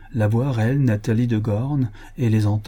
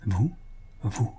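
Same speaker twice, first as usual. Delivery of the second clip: a light, hushed murmur of surprise; 8 dB quieter than usual. A narrator's reading. Man greets his unexpected visitor, murmured. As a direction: murmur it as a brief question. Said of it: "murmura-t-il".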